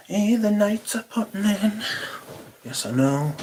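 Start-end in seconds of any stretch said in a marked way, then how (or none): 0.1-1.8 much higher-pitched mock motherly remark. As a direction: imitate mum in a high, sustained voice. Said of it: "before my mother's saying it".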